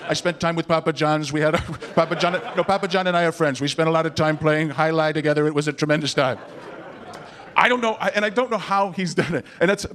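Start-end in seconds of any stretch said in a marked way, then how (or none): 0.1-6.4 name-dropping politician in a monotone; Donald Trump. Acted. Comic mimicking a candidate claiming friends, boastful monotone. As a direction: brag evenly about a famous friendship. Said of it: "he's friends with everybody".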